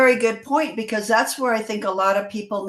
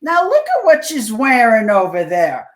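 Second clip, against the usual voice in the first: snide inner voice; 3 semitones higher, 8 dB louder than usual. Retold or imitated. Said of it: "If it says something negative".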